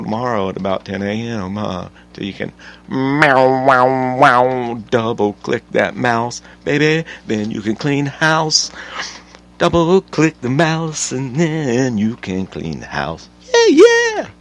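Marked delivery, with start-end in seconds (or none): 2.1-13.3 louder, slower playful tease; teasing sing-song. Acted. Teasing a stuck-at-home mom, louder and slow. 13.5-14.3 very high, loud whoop; excited shout. Real, not acted. Cheering his own joke with a loud whoop.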